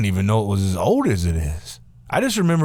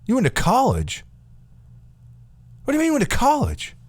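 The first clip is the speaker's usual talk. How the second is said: much higher, disbelieving what the fuck; can't believe that's the big news; incredulous outburst. Retold or imitated. Reacting to Steve's college reveal with disbelief. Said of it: "I was like".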